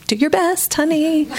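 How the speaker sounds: high, sing-song do your best; mock sweet encouragement. Wife humoring her husband on the phone, mock sweet and high. Wife's sugary send-off to husband.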